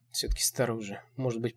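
ordinary delivery, nothing marked

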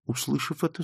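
usual speaking voice

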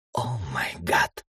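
slow, soft unvoiced mutter; whispered mutter. A narrator's reading. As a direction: breathe it out under your breath. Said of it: "тихо пробурчала мисс Фокс".

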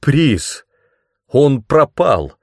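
high, loud cry of dismay; stunned exclamation. A narrator's reading. Hero runs hands over the empty pedestal, stunned exclamation. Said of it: "повторил я".

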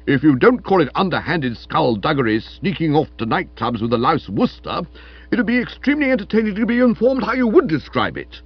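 loud indignation climbing high at the end; heated accusation. Acted. Stilton challenging Florence over nightclub outings, heated and loud. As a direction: build to a sarcastic challenge.

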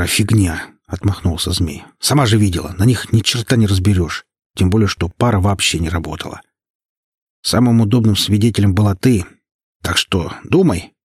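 ordinary delivery, nothing marked

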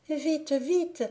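put-on fleeing girl pleading; panicked plea. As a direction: call for help in alarm. Zingora pleads again.

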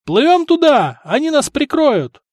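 high, urgent cry; yelling. A narrator's reading. Wounded sailor urging crew toward merchants, yelling. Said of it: "заорал раненый матрос".

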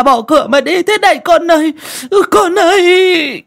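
hammed-up high-pitched keening, very loud; wailing sobs. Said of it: "vừa đi vừa mếu máo khóc".

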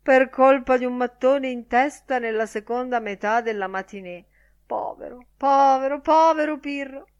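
high, theatrical lament; hammed-up tearful mourning; noisy weeping. A narrator's reading. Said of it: "pianse Lenehan con un certo rumore".

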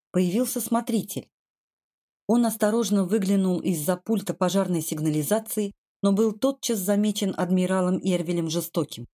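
ordinary delivery

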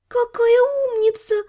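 high, doting praise; tenderly touched. Acted. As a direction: coo over a clever pet. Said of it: "умилилась".

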